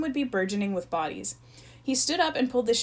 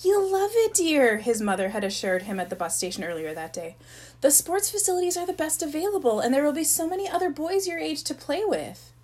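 high, sweet reassuring mother; 7 semitones higher than usual. Acted. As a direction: coax like a doting mom. Mother voiced in the story.

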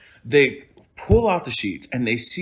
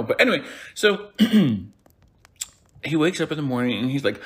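ordinary delivery

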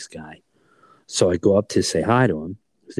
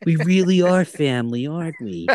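cheeky cartoon rabbit voice, much higher; Bugs Bunny voice; 8 semitones higher than usual. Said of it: "in Bugs Bunny's voice".

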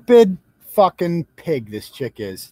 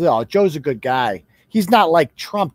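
mocking naive voter; 6 semitones lower than usual. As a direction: sneer it as a naive fan. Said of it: "that voted for Joe Biden because".

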